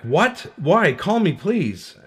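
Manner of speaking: high, pleading disbelief, drawing out the plea; alarmed reply. Acted. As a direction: read it as a panicked child's text. Child reacting to divorce text.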